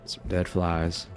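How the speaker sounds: normal talking voice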